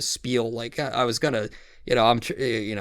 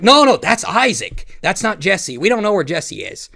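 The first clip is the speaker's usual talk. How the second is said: Boogie; 6 semitones higher, 5 dB louder than usual. Retold or imitated. Boogie insisting that's Isaac, not Jesse, high imitated protest. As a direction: impersonate him insisting, pitched up. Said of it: "That's how he fucking sounds".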